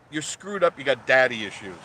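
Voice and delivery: ordinary talk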